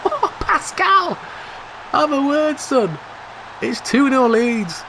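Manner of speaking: high, drawn-out gloating taunt; gloating shout. Real, not acted. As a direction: rub it in with a crowing call. Gloating at the opponent.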